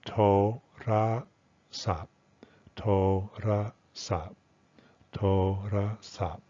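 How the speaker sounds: "to, ra, sa" with every vowel full and separate; unreduced pronunciation. Shown as a demonstration. Teacher shows learners the unreduced Thai word, "ra" said full. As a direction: say "to, ra, sa" syllable by syllable, "ra" with a full open "a". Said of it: "start first with the unreduced version".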